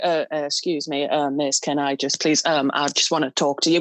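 low, hesitant, over-polite Englishman; bumbling Brit impression. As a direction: stammer apologetically in a low mock-British voice. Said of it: "I'm gonna be like".

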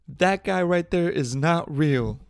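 loud, high, drawn-out mock panic; mock hysterical woman. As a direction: imitate a hysterical plane passenger, slowly. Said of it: "she was crying, being hysterical".

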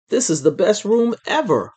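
put-on high, gushing kid's exclamation; excited. A narrator's reading. Boy thrilled with his new room, excited. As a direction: gush with a big swoop on the last word.

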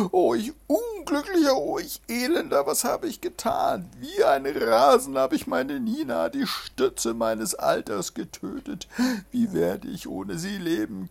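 high, loud hammed-up wailing; wailing lament. A narrator's reading. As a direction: wail over the dead maid, overdone. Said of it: "schrie überlaut". (übertrieben jammerndes Klagen)

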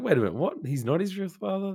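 high-pitched acted confusion; mock-baffled questioning, pitched high; retold bafflement. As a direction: act out a puzzled double take. Acts out past confusion at plot.

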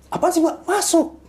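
very high, loud startled back-and-forth; panicked shouting. Retold or imitated. Friends shouting at each other in panic by the car, high and loud. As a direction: shout in alarm, no time to explain.

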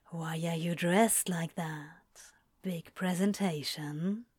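Crowley teasing low down the phone; low intimate murmur. Acted. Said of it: "low and rumbling in Aziraphale's ear".

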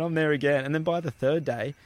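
usual speaking voice

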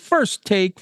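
usual speaking voice

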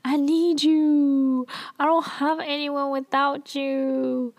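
high, slow, pleading mock sob; crying, begging. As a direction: imitate the queen begging him through tears. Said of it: "crying. She's, like, begging".

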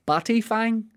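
batty fang said high and bright; playful high read of batty fang; sounding it out. Shown as a demonstration. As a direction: lift the pitch on batty fang. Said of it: "a phrase here called a batty fang".

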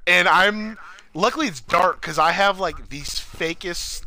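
ordinary talk, nothing marked

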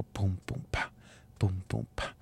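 quiet vocal drum pats; soft beat sounds. Shown as a demonstration. Dad patting a beat on baby's back, soft imitated thumps. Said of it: "just make a beat on his back".

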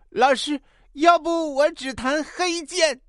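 high, slow put-on child voice; little pupil. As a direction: offer it slowly like a small schoolboy. Pupil voiced in piano joke.

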